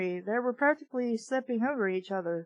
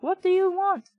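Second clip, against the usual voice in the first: higher, gruff put-on Egyptian accent; mock Egyptian accent; 8 semitones higher, 4 dB louder than usual. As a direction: ham up a bad Egyptian accent on the phone. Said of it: "I don't know what accent that was".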